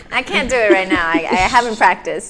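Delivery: usual speaking voice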